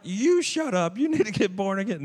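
very loud, high retort; hammed-up shouted comeback; yelling back. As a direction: fire back at full volume in a mock quarrel. Yelling back in retold quarrel.

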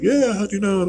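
British woman's voice. Retold or imitated. Man voicing the bride's surprised answer, high mock woman voice. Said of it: "she's like".

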